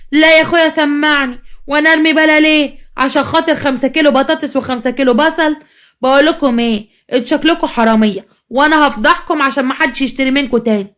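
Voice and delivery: high-pitched acted indignation; indignant quarrel. Customer threatens the stallholder with exposure, indignant and high.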